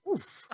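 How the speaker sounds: imitating a high grunt; high pained oof. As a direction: squeak out a hurt oof. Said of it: "Archer goes".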